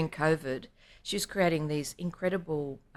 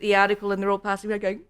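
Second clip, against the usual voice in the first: normal talking voice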